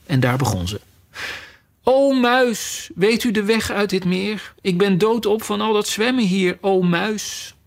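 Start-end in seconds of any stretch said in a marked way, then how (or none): 1.8-7.5 high, solemn and formal, drawing out the O; formal address to a mouse. A narrator's reading. Alice, tired from swimming, solemnly addresses the mouse with a long O. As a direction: address the mouse grandly, pitched up. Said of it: "dit leek Alice de juiste manier om een muis aan te spreken".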